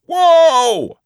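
very high, loud horsey cry of whoa; shouting whoa. Acted. Lady Betsy calling to her horse, loud high shout. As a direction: call out to a horse like a rider. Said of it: "clutching a riding crop and shouting".

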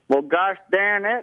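exaggerated Southern twang, drawn out; southern accent. Shown as a demonstration. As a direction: put on a slow, high Southern US accent. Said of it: "more southern accent".

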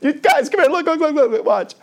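high, loud, laughing devil voice; a devil showing off his prey; gloating devil. Acted. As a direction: call his friends over to watch, gloating.

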